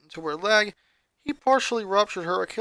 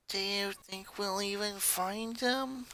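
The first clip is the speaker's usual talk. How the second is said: much softer, slow, drowsy question; barely keeping her eyes open; half asleep; 3 semitones higher, 13 dB quieter, slower than usual. A narrator's reading. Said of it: "barely able to keep herself awake".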